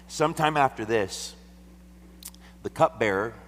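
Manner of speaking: loud, high scripture reading. Acted. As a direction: proclaim the text boldly. Reads scripture aloud, raised.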